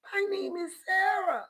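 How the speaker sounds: very high mock-girly voice; mock falsetto. Vlogger mocking a person in a video, very high falsetto. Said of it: "mocking the person in video".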